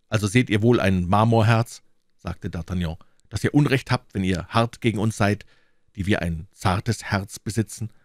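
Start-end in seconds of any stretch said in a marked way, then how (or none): none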